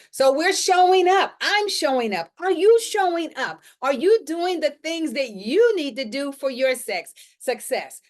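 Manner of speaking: high, loud rallying call to action; rousing challenge. Real, not acted. Host challenges viewers to show up for their success, rousing.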